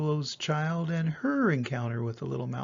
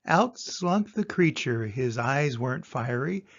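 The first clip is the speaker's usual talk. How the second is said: ordinary delivery, nothing marked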